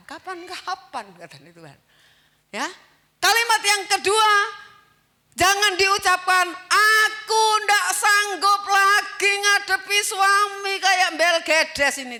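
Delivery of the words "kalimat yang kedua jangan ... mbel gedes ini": loud, slow preaching quote; fervent preaching. Acted. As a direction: hammer out the forbidden complaint. Preaching second warning. (khotbah keras, pelan)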